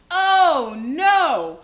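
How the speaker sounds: big, loud storybook alarm; exaggerated, high, loud dismay; alarmed cry. A narrator's reading.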